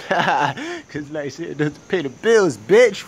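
slow, drawn-out sassy comeback; snarky self-answer. Acted. Answering his own mirror question with a slow sassy retort. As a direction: answer yourself with slow attitude.

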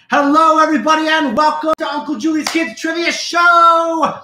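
loud, high, bright welcome; show host greeting. Real, not acted. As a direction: open the show with a big hello. Host's opening show greeting.

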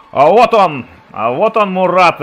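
loud, high cheer for the scorer; excited cheering. Real, not acted. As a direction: celebrate the goal, voice still up. Celebrating the goal scorer.

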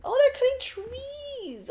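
high, drawn-out exclamation; excited squeal. Real, not acted. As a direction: squeal it out as you suddenly spot something. Sudden sighting breaks into talk.

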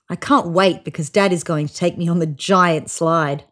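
plain reading voice

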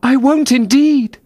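high, emphatic promise; anxiously agreeing Alice. A narrator's reading. Alice rushes to appease the mouse, high anxious assurance. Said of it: "in a great hurry to change the subject".

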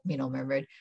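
ordinary delivery

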